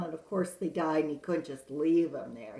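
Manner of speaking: ordinary delivery, nothing marked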